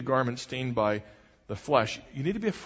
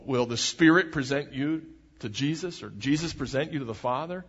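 ordinary delivery

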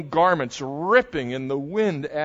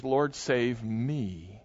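quiet pleading; 4 semitones lower, 6 dB quieter, slower than usual. Acted. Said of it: "He says".

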